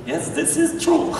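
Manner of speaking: high-pitched mimicry of a German accent; parroting a German accent in a high voice; imitated German accent. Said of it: "repeat words that they say".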